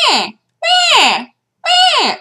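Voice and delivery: high repeated mock meows; choked cat meows. Imitating the cat's choking fit, high meows. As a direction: hack out little cat coughs. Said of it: "he spends a couple of seconds going".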